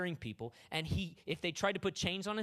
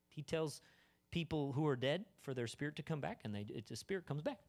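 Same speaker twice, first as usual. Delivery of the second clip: usual speaking voice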